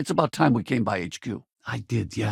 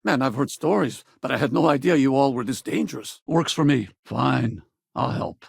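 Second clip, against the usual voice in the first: usual speaking voice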